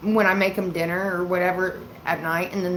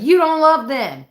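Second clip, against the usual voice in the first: high, loud, drawn-out teasing protest; mock accusation; 8 semitones higher, 8 dB louder than usual. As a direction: needle dad with an exaggerated accusation. Retold teasing quarrel with dad.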